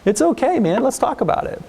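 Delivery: reassuring therapist. Shown as a demonstration. Therapist voicing calm validation to teen.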